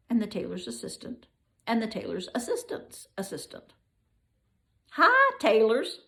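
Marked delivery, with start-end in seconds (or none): 4.9-6.0 cheery lion. Acted. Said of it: "said the lion".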